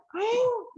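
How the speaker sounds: a sharp high gasp of shock; mock gasp. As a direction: gasp as if you can't believe your ears. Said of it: "you go like".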